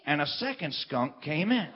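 normal talking voice

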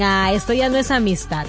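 ordinary talk

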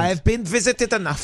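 mock German accent, lightly done; German accent, not too heavy. Shown as a demonstration. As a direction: say it like a blunt German host. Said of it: "but in a German accent".

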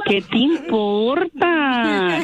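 slow, stretched-out indignant retort; real exasperated none-of-your-business. Real, not acted. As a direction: drag out every word at a nosy caller. Indignant at nosy caller.

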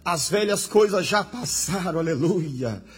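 loud, fervent pulpit climax; impassioned preacher proclaiming to the congregation; full-force preaching. Real, not acted. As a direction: drive each phrase home with rising intensity.

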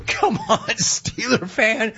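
high, laughing come on; laughing mockery at rival fans; laughing taunt. Real, not acted. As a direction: tease them through a laugh.

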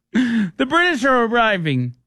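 high, hammed-up British gent; mock British accent. Mocking the British on air, in a hammed-up British accent. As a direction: play the stuffy Brit.

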